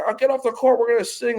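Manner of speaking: high-pitched mock announcement; imitating the player. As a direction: lightly mimic a player, voice jumping up high. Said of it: "if you're gonna say".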